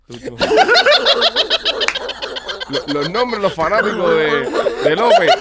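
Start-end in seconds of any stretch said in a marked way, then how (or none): 0.4-2.6 loud high cackle; burst of laughter. Real, not acted. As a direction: explode into laughter. Host cracks up at names.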